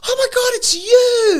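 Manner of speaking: very high, loud mock squeal of delight; imitating excited shout. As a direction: play a gushing American spotting a friend. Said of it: "but they're like".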